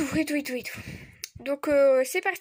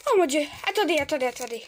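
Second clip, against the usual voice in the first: normal talking voice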